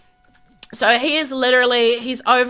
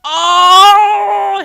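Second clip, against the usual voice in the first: loud high oh; loud startled oh; excited oh; 6 semitones higher, 8 dB louder than usual. Real, not acted. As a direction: exclaim it. Excited exclamation.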